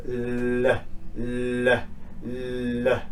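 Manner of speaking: "l" held long as a humming sound; voiced L. Shown as a demonstration. Teacher showing voiced sounds, a long vibrating "l". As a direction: sustain "l" so the throat vibrates. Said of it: "If you say the L sound".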